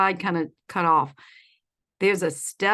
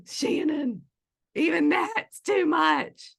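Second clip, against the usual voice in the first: mock shocked gasp; 8 semitones higher, much slower than usual. Real, not acted. Shocked at Shannon's comment.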